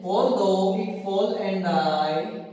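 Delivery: slow, drawn-out line reading. Shown as a demonstration. Teacher rereads the poem line slowly for students. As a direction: stretch the whole line out slowly. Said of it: "teacher reads poem".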